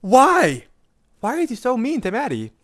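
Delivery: high, loud indignant cry; outraged yell. Real, not acted. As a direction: cry out in disbelief. Streamer outraged defending friend.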